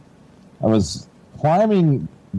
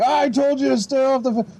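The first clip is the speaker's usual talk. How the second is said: higher mock lecture of an annoyed father; scolding dad; 12 semitones higher than usual. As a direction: nag it like a father who warned you. Dad scolding son in story.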